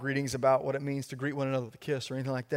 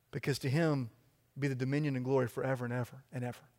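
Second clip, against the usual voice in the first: normal talking voice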